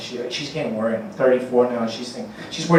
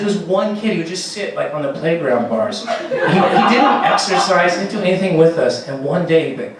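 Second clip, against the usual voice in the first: normal talking voice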